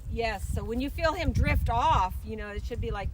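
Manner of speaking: called out across the arena; brisk projected riding commands. Real, not acted. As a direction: project loud instructions to the rider. Instructor calling out to rider.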